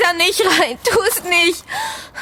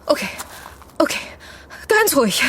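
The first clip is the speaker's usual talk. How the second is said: normal talking voice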